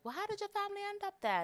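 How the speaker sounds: high, quick curious question; curious listeners. Retold or imitated. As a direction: rattle it off like a nosy classmate. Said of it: "they'll be like".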